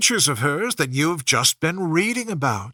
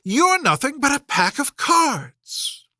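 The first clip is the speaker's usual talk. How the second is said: scornful Alice; 9 semitones higher than usual. A narrator's reading. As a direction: defy them, unafraid. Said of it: "grown to her full size".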